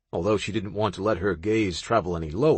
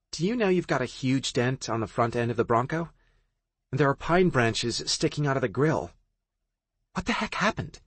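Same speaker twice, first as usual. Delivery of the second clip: curious friend; 5 semitones higher than usual. Acted. Friend voiced in higher voice.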